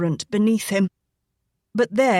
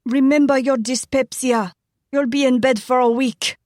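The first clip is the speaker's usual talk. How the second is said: higher fretful warning; higher fussing wife; cautioning wife; 5 semitones higher, 3 dB louder than usual. Acted. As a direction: remind him of his health, pitched up. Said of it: "Layla cautioned".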